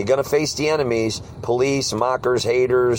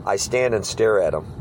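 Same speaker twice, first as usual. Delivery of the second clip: ordinary talk, nothing marked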